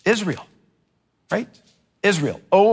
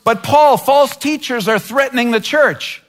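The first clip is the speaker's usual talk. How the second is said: whiny complaint; 6 semitones higher than usual. Pretend. Church member complaining to Paul, higher and whining. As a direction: plead with Paul like an aggrieved believer.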